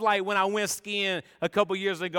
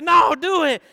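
higher, louder urging; loud push; 9 semitones higher, 10 dB louder than usual. Retold or imitated. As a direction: command him to go. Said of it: "And they said".

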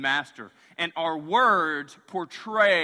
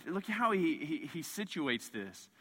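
normal talking voice

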